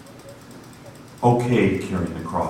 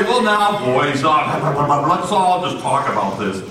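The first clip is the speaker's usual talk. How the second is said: higher, soothing the crowd; mild calming; 5 semitones higher, 3 dB louder, slower than usual. Retold or imitated. Preacher calming the angry workers, soothing. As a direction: try to calm them down. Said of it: "So I say".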